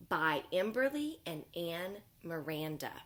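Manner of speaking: usual speaking voice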